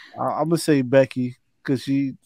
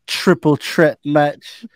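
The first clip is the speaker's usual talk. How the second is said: slow, drawn-out "triple threat match." in a mock Irish accent; mocking Becky's Irish accent; much slower than usual. Mocking how Becky says "triple threat match." in Irish accent. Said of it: "I don't like the way she says".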